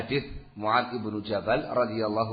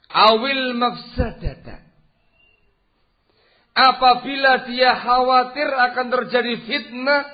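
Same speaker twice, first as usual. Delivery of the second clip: fiery preaching; 10 semitones higher, 9 dB louder than usual. Real, not acted. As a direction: proclaim the rule. Preaching at full force. (ceramah lantang, lebih tinggi dan keras)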